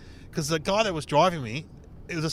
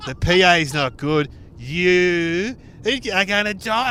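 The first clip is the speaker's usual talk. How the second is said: mock menacing boss; 4 semitones higher, 7 dB louder, slower than usual. Boss taunt, rising to punchline.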